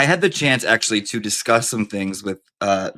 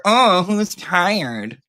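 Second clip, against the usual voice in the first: mocking quote; 7 semitones higher, much slower than usual.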